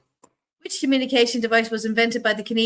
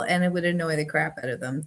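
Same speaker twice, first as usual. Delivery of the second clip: normal talking voice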